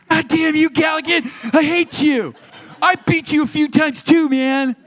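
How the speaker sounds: high, loud mock-outraged Corey; Corey Feldman impression. [name] imitating Corey Feldman cursing him over Food Fight. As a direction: whine it like Corey Feldman sore about losing. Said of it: "excellent Corey Feldman impersonation".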